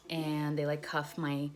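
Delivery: usual speaking voice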